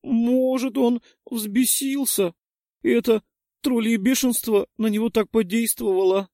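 put-on high, earnest girl suggesting; high little girl. A narrator's reading. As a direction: offer a guess sweetly. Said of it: "предположила Кэссиди".

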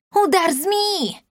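very high, drawn-out cry; piercingly high attack call; shouted battle cry. A narrator's reading. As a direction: call out a spell, stretching it high. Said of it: "крикнула девчонка".

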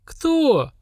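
high, startled question; astonished. A narrator's reading. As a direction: blurt it out in disbelief. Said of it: "закашлялся Слава, вытаращив глаза от удивления".

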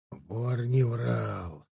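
slow, soft inner voice; inner thoughts. Acted. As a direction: think it quietly to yourself. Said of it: "размышлял он".

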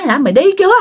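challenging shout. Retold or imitated. Ông ngoại chém, quát ma.

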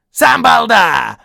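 high, drawn-out shouted insult; cutting retort. A narrator's reading. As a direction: yell the insult straight back. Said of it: "отрезал Том".

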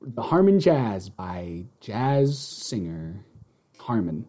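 slow, low jazz DJ introduction; radio show host. Acted. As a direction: draw out the song credit, deep and unhurried. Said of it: "open our show like a radio show".

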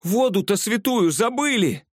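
high breathless cry while running; breathless shout. A narrator's reading. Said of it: "задыхаясь, кричала девчонка". (запыхавшийся крик на бегу)

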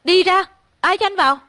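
high, loud order to get out; high-pitched startled yell; shouting. A narrator's reading. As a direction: shout it at an unwelcome intruder. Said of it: "tôi quay người lại hét lên".

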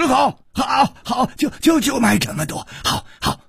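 high, stammering eagerness; panicky, breathless yes; desperate agreement. A narrator's reading. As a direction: accept frantically, tripping over the words. Desperate customer agrees at once.

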